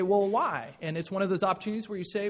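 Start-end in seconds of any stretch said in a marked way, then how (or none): 0.0-0.7 put-on a curious child asking why, slightly louder and higher; sincere child's why. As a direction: ask why with real curiosity, like a child who wants to know. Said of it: "and they say".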